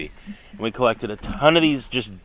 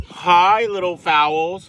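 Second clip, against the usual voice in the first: cheery greeting; 7 semitones higher, 7 dB louder, much slower than usual. Real, not acted. Greeting the new birds.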